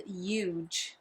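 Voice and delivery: "huge" said as "yuge". Retold or imitated. Imitating people who say herbs, "huge" without the H. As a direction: drop the H from "huge", start on a Y. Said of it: "so there's no, like, no H".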